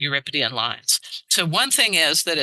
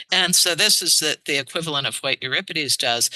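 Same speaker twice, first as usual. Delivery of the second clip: normal talking voice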